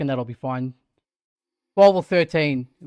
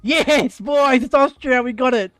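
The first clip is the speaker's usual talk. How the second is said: excited; 5 semitones higher, 4 dB louder, slower than usual. Real, not acted. Finally guessing a flag right.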